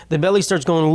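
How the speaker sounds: ordinary delivery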